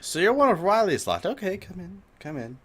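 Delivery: high-pitched welcoming character voice; Lucille. Acted. Game master voices Lucille.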